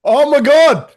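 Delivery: loud, high excited exclamation; the host is overjoyed to see Rakaya, shouting with joy; thrilled. Real, not acted. Real excitement.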